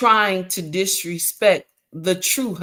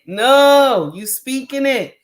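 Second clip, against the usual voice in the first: ordinary talk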